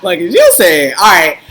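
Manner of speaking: high laughing burst; can't stop laughing; cracking up; real laughing. Real, not acted. Still laughing hard.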